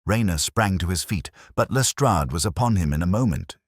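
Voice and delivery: ordinary delivery, nothing marked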